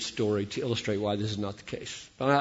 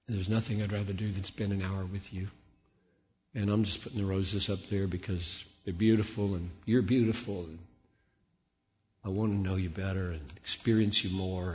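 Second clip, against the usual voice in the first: softer, tender prayer; sincere devotion; 4 semitones lower, 6 dB quieter than usual. Shown as a demonstration. Worshipper telling God he loves him, soft and sincere. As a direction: speak softly to God.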